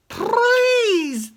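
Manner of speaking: second, better try at Roger Rabbit's "Please". Retold or imitated. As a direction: beg "Please" in a shrill cartoon rabbit squeak. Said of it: "That was not bad".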